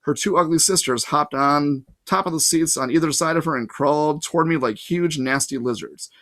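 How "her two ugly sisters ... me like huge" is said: usual speaking voice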